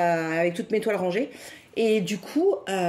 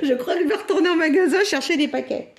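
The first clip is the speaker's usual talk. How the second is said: giggling, louder and higher; laughing; 8 semitones higher, 4 dB louder than usual. Real, not acted. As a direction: laugh at your own sweet tooth. Laughs at her own greed.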